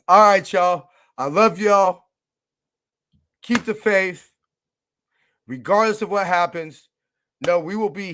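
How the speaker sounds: loud, high rally to the fans; fired-up sign-off. Real, not acted. Host signing off to his listeners, loud and hyped.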